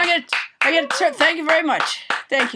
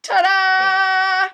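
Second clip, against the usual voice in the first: bright triumphant ta-da; 4 semitones higher, 4 dB louder than usual. Pretend. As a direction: announce the landing proudly. Finishing tightrope walk.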